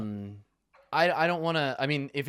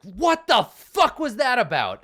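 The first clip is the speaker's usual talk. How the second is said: high, loud furious scream; screaming rage; 13 semitones higher, 9 dB louder, slower than usual. Acted. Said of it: "like, screaming".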